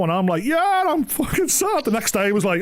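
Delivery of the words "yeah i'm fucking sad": high, slow and whiny; mock pathetic reply. New hire answering his workmate, high slow mock whine. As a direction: whine it out pitifully.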